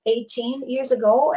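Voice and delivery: normal talking voice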